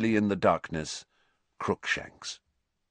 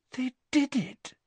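disbelief; 3 dB quieter than usual. A narrator's reading. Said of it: "she whispered to Harry".

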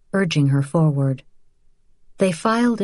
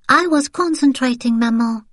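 put-on high child's voice, pleased with herself; a small child showing off her effort; proud little girl; 8 semitones higher than usual. A narrator's reading. Little daughter to her mother, high proud child voice. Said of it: "Danielle said proudly".

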